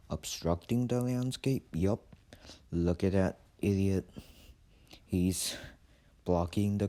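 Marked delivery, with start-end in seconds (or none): none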